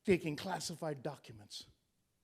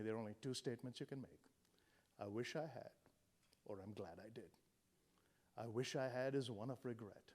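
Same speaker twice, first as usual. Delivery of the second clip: soft quoting; 8 semitones lower, 10 dB quieter than usual. Retold or imitated. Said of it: "My friend Vicky Hitchcock from Dallas says".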